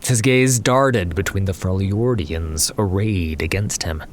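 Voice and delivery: ordinary delivery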